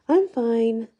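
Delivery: voice jumps high. Acted. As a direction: blurt it out high and tight. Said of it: "the words come out about two octaves higher than normal".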